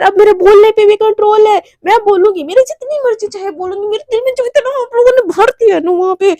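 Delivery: high-pitched, mildly exasperated protest; indignant retort. Acted. Mishka answers complaint she talks too much, indignant. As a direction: protest being told to stop talking. Acted retort to being silenced.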